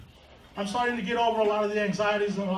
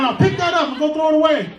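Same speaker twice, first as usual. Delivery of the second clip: stern order; 4 semitones higher, 5 dB louder than usual. Retold or imitated. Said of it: "Be like".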